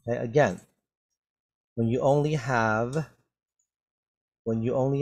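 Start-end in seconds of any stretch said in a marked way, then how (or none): none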